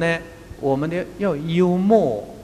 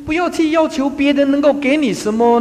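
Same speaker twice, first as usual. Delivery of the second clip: 不要去要求 said higher and louder, stress on 要; raised, emphatic restatement; 10 semitones higher, 6 dB louder than usual. Real, not acted. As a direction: hammer the point home loudly. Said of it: "因为". (提高音量强调“不要去要求”)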